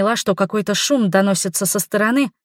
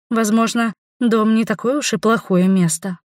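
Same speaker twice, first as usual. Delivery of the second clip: ordinary delivery, nothing marked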